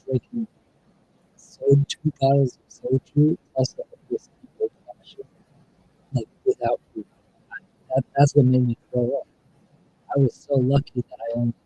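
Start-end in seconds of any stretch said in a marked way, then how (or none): 1.4-11.5 breaking-up audio. Real, not acted. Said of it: "I can't hear you at all now".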